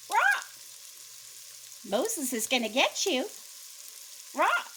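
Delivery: parrot squawk. Acted. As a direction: screech it like a guard parrot. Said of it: "the parrot said".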